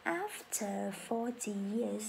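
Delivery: very slow, syllable by syllable teacher read; slow model sentence. Shown as a demonstration. Teacher reading for class to repeat, very slow. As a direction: drag it out so learners can follow.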